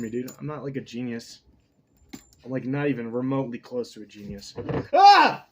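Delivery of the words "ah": sharp, high, very loud yelp of real pain. Real, not acted. Burned by hot tea, a loud high yelp. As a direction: cry out from a scalding sip. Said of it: "Fucking hot tea".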